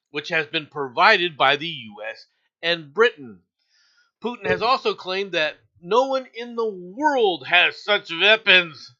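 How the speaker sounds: loud, deliberate newsreader delivery; news read. Acted. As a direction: read the headlines like a TV anchor, projecting. Reads news headlines aloud.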